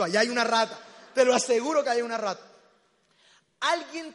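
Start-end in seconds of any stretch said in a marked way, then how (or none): 0.0-2.4 loud, acted-out insistence; emphatic insistence. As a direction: insist loudly, imitating a friend who swears it. Amigo insiste en que vio la rata. (insistencia enfática a voces)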